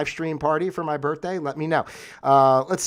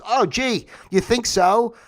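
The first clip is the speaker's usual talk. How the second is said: high-pitched mock realization; scornful fake wonder; sarcastic; 8 semitones higher, 4 dB louder, slower than usual. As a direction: answer with exaggerated obviousness. Sarcastic reply to the account.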